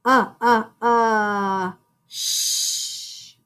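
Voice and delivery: isolated "uh, uh" vowel sounds then "sh-" hushed; segmenting sounds one by one; sounding out. Shown as a demonstration. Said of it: "hear that C R".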